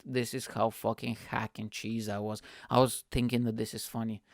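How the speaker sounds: normal talking voice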